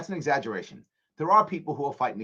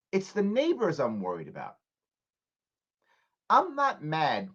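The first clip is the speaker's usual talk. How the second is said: ordinary delivery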